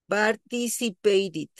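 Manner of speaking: slow "participated" ending in "tid"; "participated" pronounced with I. Shown as a demonstration. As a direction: say "participated" slowly, last vowel as I. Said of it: "ya lo pronuncio con I".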